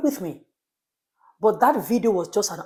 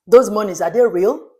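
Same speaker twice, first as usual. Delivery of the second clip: louder, pressing rhetorical question; incredulous; 7 dB louder than usual. Real, not acted. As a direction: demand it in disbelief.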